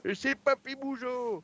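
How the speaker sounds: put-on high, slow very old man voice; Papy Mougeot. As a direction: wobble it out like a very old grandpa. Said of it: "C'était la voix de Papy Mougeot". (voix de vieux papy, aiguë et lente)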